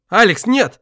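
sharp alarmed cry, pitched high; shout. Acted. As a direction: yell to stop him. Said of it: "крикнул Гюрза".

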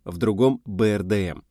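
plain reading voice

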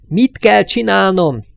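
high, loud and slow, leaning on KELL; slow shouted demand. Acted. Störmer shouts slowly at the fading driver, demanding instructions. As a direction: spell it out to him, shouting.

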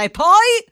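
high, loud oratorical push; sudden lift to grab attention; emphatic. Acted. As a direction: punch it like a rally speaker.

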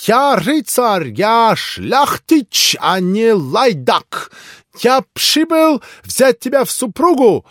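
put-on high, loud, slow Polish-accented tirade; loud wounded nobleman's tirade; proud angry pan. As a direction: hurl proud insults at her. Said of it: "закричал пан".

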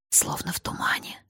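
hushed whisper. A narrator's reading. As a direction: whisper it softly. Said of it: "Ларион говорил шёпотом".

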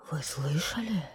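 shaky frightened whisper; trembling whisper. A narrator's reading. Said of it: "прошептал бургомистр дрожащим голосом". (дрожащий шёпот)

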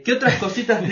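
high, loud laughing question. Real, not acted. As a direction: laugh through the words. Host laughs asking next item.

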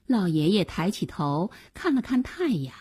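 usual speaking voice

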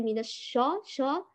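الشا with dark, backed shaw; emphatic, rounded-back sha in الشا; heavy vowel. Shown as a demonstration. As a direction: thicken the aa of شا. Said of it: "بتفخيمها".

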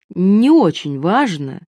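stretched, pointed emphasis on очень; stress on очень. A narrator's reading. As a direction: lean long and slow on очень. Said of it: "она подчеркнула слово".

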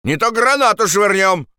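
high-pitched threat; shouting. Acted. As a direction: shout a warning from above. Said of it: "крикнул кто-то". (угрожающий крик)

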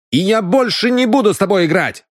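high, indignant outburst. Acted. Сандал refuses to play with a cheat, indignant. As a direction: raise the pitch and refuse angrily.

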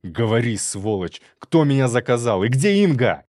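loud interrogation building to a shout; angry, escalating shouted demand; furious demand. Acted. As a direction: roar the questions at a captive.

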